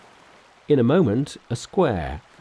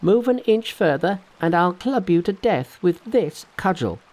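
high, loud threat with a raised club; angry threat; 8 semitones higher, 3 dB louder than usual. Acted. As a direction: warn the turtle off fiercely. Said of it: "cried Monkey".

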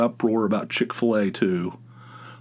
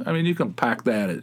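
ordinary talk, nothing marked

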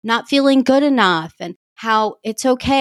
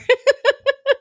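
a quick, high self-aware laugh; high light giggle; short laugh. Real, not acted. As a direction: chuckle at herself. Laughs after admission.

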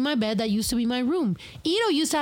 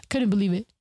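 normal talking voice

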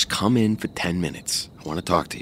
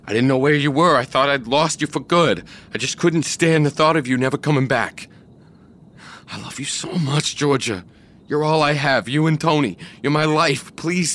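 higher, louder desperate plea; emotional pleading; 6 semitones higher, 3 dB louder than usual. Acted. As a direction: pour out the need.

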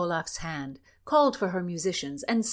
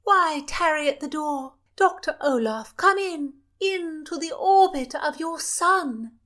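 high, loud royal command; high, loud princess voice calling the chaplain in; imperious princess; 9 semitones higher, 7 dB louder than usual. Acted. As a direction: summon him like a spoiled princess.

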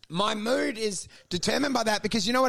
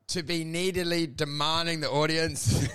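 ordinary talk, nothing marked

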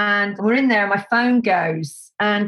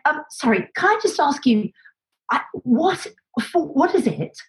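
baffled; 7 semitones higher than usual. Retold or imitated. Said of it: "Like that".

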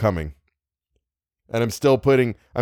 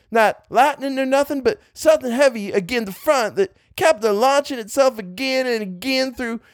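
much higher backwoods farmer dialect; rural dialect; 12 semitones higher than usual. Acted. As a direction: push the old farmer's dialect up high. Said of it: "I'm gonna one-shot the dialect".

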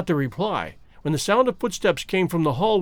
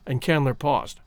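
ordinary delivery, nothing marked

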